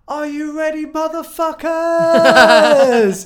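big rock-frontman yell, way up high and loud; screamed crowd call with a laugh; stage shout. Retold or imitated. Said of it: "and I immediately said".

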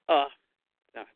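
ordinary talk, nothing marked

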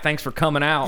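comic on tour sounding Southern; Southern accent on the mic. Retold or imitated. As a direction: pick up the local Southern twang. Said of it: "I'm like this on a mic".

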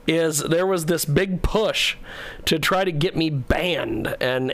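ordinary delivery

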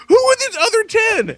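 high, indignant mock protest; retold incredulous challenge. Dad challenging his daughter's top ten ranking, incredulous.

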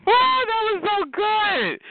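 high, slow, delighted exclamation; gleeful gloating. Real, not acted. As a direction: savor it in a high, stretched-out voice. Gloating after the caller leaves.

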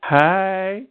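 a hint of Chelsea Manning greeting.

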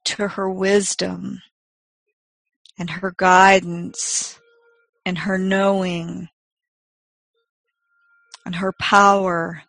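slow, soft meditation voice; guided meditation. Acted. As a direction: gently guide them inward, unhurried.

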